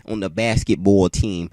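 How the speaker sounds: loud, exaggerated New York "basketball"; imitated New York accent. Repeating exactly how the classmate said it, imitated New York accent. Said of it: "that's exactly how she said it".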